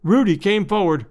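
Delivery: ordinary delivery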